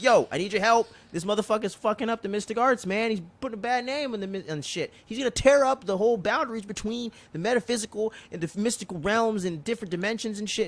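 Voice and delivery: high-pitched mock alarm; agitated complaint. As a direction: plead your case in an acted grievance. Acting Wong's urgent complaint to lawyer.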